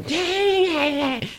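high nasal mock laugh of a girl; imitating the annoying voice. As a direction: mimic the worst voice imaginable. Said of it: "it's the worst voice you can imagine".

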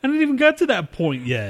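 high-pitched indignant objection; exasperated protest. Real, not acted. Storyteller to co-host who jumped ahead, exasperated. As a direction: protest at being cut short in your story.

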